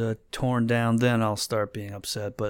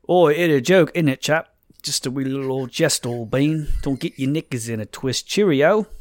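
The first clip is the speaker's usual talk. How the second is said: loud, hammed-up Cockney geezer; mock Cockney accent; 6 dB louder than usual. Host reading a joking reply to the research complaint, mock Cockney. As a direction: ham it up as a cheery cartoon Cockney. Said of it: "more specifically Cockney".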